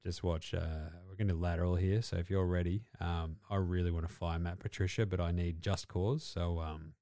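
low, quiet mumbling coach; a coach quietly handing the play over, low and mumbled; Belichick impression. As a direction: mumble it low and deadpan like a weary old coach. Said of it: "Belichick just going".

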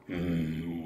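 low, slow evil villain greeting. Shown as a demonstration. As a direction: sneer it like a cartoon villain. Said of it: "you should be like".

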